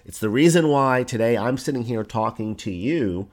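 ordinary talk

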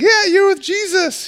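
put-on very high, squeaky little-girl voice; sweet little girl. Little girl pointing Peter out, high girlish voice. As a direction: put on a piping little girl, far above your own pitch. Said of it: "this sweet little girl".

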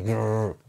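a short playful dog growl; light imitated snarl; mock growl. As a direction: growl on command, half-hearted. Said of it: "Ahora gruñe".

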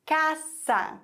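Casa with an ordinary hissed S; normal S sound. Shown as a demonstration. Teacher repeats the example, plain S in Casa. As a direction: lengthen Casa, keeping a plain S. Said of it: "ganz normal das S".

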